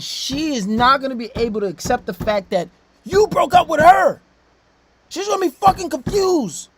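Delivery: heated shout over a cheater's breakup; excited shout. Real, not acted. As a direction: get worked up until you shout. Excited rant about the breakup.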